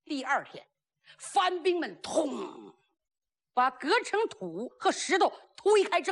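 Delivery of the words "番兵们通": plain reading voice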